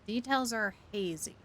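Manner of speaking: normal talking voice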